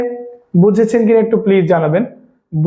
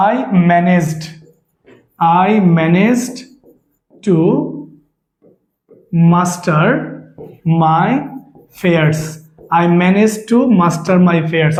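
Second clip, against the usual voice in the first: slow dictation; much slower than usual. Shown as a demonstration. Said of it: "teacher reads example sentence".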